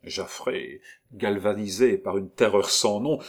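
ordinary delivery

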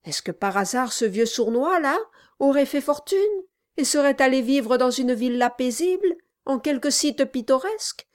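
loud, fast, sneering dwarf; mock him with false sweetness. A narrator's reading. Dwarf mocking.

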